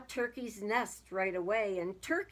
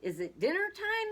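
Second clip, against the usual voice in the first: high-pitched call across the yard; high, lilting holler; 10 semitones higher, 4 dB louder than usual. A narrator's reading. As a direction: call it out high, like a hungry goat. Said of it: "he called".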